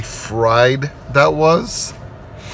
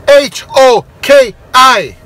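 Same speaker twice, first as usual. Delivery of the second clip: spelled out; 8 semitones higher, 6 dB louder than usual. Retold or imitated. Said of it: "And he said".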